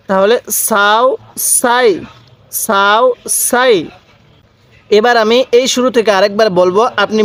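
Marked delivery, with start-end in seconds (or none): 0.1-3.7 "সাও", "সাই" with a thin, sharp s; thin Sin sound. Shown as a demonstration. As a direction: drill "সাও সাই" with fine s, not sh. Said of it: "সিনের উচ্চারণটা অনেকটাই চিকন আকারে হবে". ("সাও সাই"-তে চিকন স-ধ্বনি)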